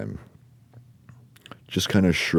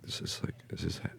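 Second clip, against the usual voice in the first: hushed unvoiced whisper, trailing off; breathless whispered awe while floating up; awed whisper; 5 semitones higher, 11 dB quieter than usual. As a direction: whisper it in wonder, breaking off. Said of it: "I'm like".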